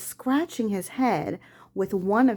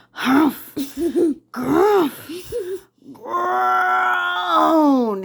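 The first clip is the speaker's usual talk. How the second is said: high, hammed-up grunts and groans, louder; grumbling groans; 8 semitones higher, 4 dB louder, much slower than usual. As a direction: groan out the strain like lifting something heavy. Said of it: "he muttered under his breath".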